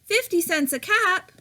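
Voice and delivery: high, sing-song street cry of a cap seller; peddler calling out. A narrator's reading. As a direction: call your wares down the street, high. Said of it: "he called".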